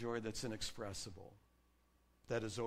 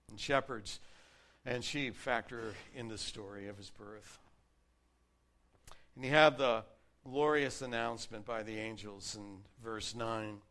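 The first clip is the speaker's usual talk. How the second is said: ordinary talk, nothing marked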